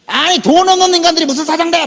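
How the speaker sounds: high, indignant jeer; scornful browbeating. Retold or imitated. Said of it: "막 택도 없이 막 몰아대니까". (깔보듯 목소리 높여 쏘아붙임)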